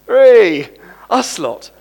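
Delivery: loud, high shout of delight; jubilant cheer. Real, not acted. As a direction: cheer for the whole congregation. Cheering that congregation is the joy.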